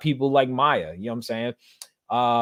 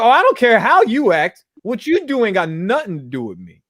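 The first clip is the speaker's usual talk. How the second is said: high, loud dismissive retort; self-assured retelling of "not my problem"; defiant indifference; 7 semitones higher, 6 dB louder than usual. Retold or imitated. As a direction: brush them off boldly, voice up high. Quoting own newfound carefree attitude.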